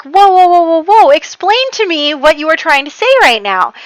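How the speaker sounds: high, loud volley cutting him off; acted alarm, putting the brakes on; alarmed interruption. Serena halts Emil's tearful outburst, alarmed interruption. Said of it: "she's like".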